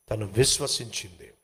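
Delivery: ordinary talk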